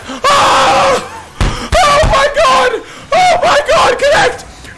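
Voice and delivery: screaming with joy. Real, not acted. Streamer to chat after a rare red, screaming with joy. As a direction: scream it like you called the jackpot. Waiting for the connect.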